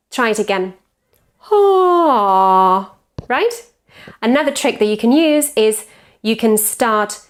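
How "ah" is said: drawn-out yawn falling to a held ah. Shown as a demonstration. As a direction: glide from a yawn to a back ah. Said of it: "go from a yawn to R".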